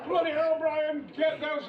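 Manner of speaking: high laughing mock outburst; hammed-up line reading. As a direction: blurt the line while laughing. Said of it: "I had all those lines to say".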